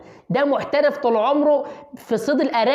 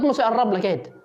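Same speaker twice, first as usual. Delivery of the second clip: normal talking voice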